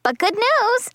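put-on eager kid's sales pitch; upbeat announcement. Child brightly offers good news.

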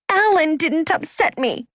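high-pitched, heated denial; angry yell. A narrator's reading. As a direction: yell it high without much volume. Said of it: "she yelled".

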